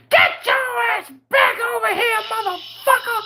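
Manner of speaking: very high-pitched mimicked scream; screaming mother. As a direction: scream it like a mother calling her child out of traffic. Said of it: "and she screams".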